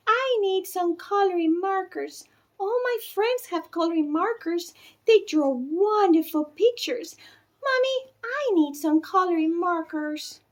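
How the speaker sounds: put-on high, pleading little girl voice; a small daughter nagging her mother for markers; little girl begging. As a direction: whine for markers like a small child. Narrator voices child Bridget.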